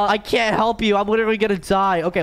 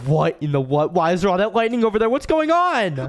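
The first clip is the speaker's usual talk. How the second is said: normal talking voice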